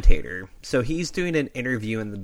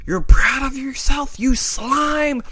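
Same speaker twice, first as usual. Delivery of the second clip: higher, louder indignant yell; old vet loses his temper at the heel; angry outburst; 13 semitones higher, 5 dB louder, slower than usual. Retold or imitated. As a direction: spit it at him in disgust. Bruno snaps at Savage.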